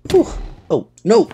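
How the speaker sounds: sudden high alarmed oh no; startled cry. Real, not acted. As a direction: yelp in alarm. Dragon attacks suddenly.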